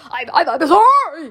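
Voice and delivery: fast, loud, frightened stammer; spluttering. A narrator's reading. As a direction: splutter out a panicked apology. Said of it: "he spluttered".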